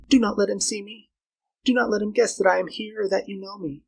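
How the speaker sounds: high, urgent female voice; cautioning Flora. Acted. Girl calling Carl back with a warning, anxious.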